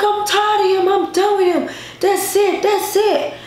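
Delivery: high, whiny crying voice, retold; imitated crying. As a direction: act out crying down the phone. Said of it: "I was crying".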